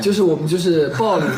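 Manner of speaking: normal talking voice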